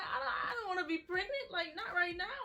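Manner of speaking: high, loud, laughing and crying at once; half laughing, half crying in panic; nervous laugh-crying. Retold or imitated. Telling her sister she's not ready: high, loud nervous laugh-cry. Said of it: "But I'm nervous".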